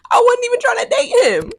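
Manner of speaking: high-pitched exasperated squeal; exasperated protest. Real, not acted. Exasperated aside about the man.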